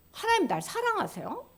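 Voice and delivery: ordinary talk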